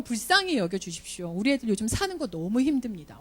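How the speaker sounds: usual speaking voice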